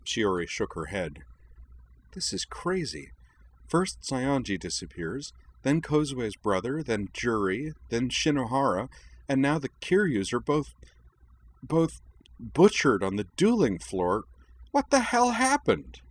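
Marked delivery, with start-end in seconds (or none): 2.1-14.2 high, agitated voice, building in volume; mounting distress. Acted. Girl listing vanished and butchered schoolmates, growing distraught. 14.7-16.0 very high, frantic cry; distraught outburst. Acted. Girl demanding answers about the deaths, frantic outburst.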